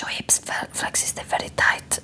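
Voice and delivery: put-on soft whisper in Spanish accent; hushed, accented diagnosis; imitated Spanish masseuse. Spanish masseuse assessing client's hips, imitated whisper. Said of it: "like, this beautiful accent".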